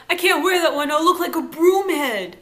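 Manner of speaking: put-on high, loud whiny teen protest; whiny Joey. Joey whining loudly to his dad about the jacket. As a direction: whine it like a sulky teenager. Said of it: "Joey's like".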